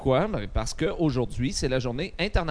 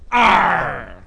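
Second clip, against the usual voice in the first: a higher, louder pirate arr; 5 semitones higher, 8 dB louder than usual. Shown as a demonstration. Host teaching listeners pirate words, punchy arr. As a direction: show the second arr with more push. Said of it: "second mot".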